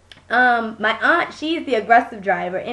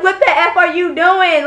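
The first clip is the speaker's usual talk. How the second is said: retold angry outburst, higher and louder; raised, exasperated challenge; indignant confrontation; 6 semitones higher, 5 dB louder than usual. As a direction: demand an explanation from a bad driver. Confronting the other driver, retold.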